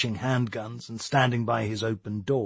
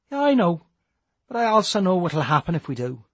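put-on young Irish lad voice; 6 semitones higher than usual. Matty's voice.

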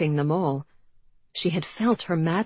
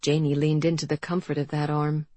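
usual speaking voice

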